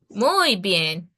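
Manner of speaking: ordinary delivery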